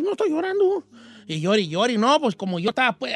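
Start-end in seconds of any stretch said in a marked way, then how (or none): 0.0-0.8 put-on very high little-boy voice; imitating a tearful small boy; little kid denying tears. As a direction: squeak out a childish denial. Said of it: "Era como los chiquillos".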